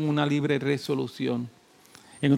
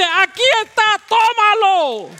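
much higher, loud, slow triumphant cry; joyful shout; 9 dB louder, slower than usual. Acted. The Father answering the seeker, a loud slow triumphant shout. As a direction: shout it out like handing over a gift. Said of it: "el Padre dice".

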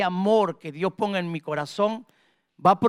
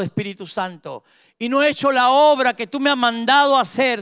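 fervent prayer; 4 semitones higher, 4 dB louder than usual. Real, not acted. Preacher leading the congregation to ask God for love, fervent prayer. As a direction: cry out to God, phrase by phrase.

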